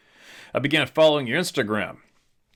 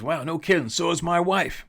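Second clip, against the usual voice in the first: put-on high, curious old driver asking; cab driver's voice; 7 semitones higher than usual. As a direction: pitch up into the cabbie's friendly question. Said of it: "He goes".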